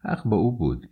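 ordinary delivery, nothing marked

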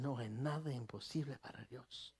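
soft, low inner voice in Spanish; soft Spanish line. Acted. The woman's inner faith speaking, soft Spanish murmur. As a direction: murmur it like a private conviction.

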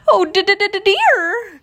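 high, loud panicked stammer; Piglet crying out. A narrator's reading. Piglet alarmed by poison ivy, crying out high. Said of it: "cried Piglet".

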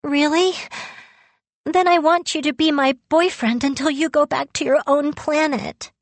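high-pitched, hopeful eagerness; eager hope. A narrator's reading. Girl asking Yu to be her boyfriend, eagerly hopeful. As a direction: lift the voice high and make an eager request.

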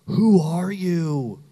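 loud, drawn-out Southern challenge; thick Southern accent. Retold or imitated. Startled mother finds strangers on her couch, thick Southern accent. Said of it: "in a really thick Southern accent".

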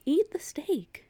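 high, hushed plea; whispering advice to Andy at the sink. Retold or imitated. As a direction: whisper it urgently. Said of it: "and I'm like".

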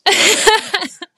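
loud, high burst of laughter; real laughter bursting out. Real, not acted. Laughing at her own story.

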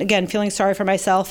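normal talking voice

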